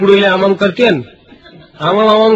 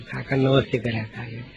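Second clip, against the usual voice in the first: hushed greeting; 7 semitones lower, 13 dB quieter than usual. Retold or imitated. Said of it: "શાંતિથી વાત કરવી".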